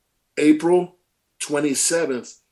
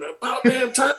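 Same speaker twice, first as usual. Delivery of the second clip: mock scream; 10 semitones higher than usual. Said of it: "my dad screamed".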